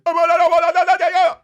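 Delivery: sudden high squeal on I; a high startled yelp; mock gasp. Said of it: "was like this".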